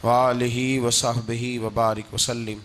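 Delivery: low, measured sacred recitation; Arabic recitation. Acted. Preacher opens his sermon with Quran verse and blessings, solemn recitation.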